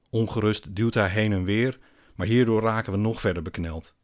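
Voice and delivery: plain reading voice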